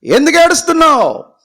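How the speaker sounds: high, loud, booming God rebuke; God rebuking. Acted. God scolds weeping Moses, high and loud. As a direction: thunder it out as an offended God. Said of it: "అప్పుడు దేవుడు అంటున్నాడు".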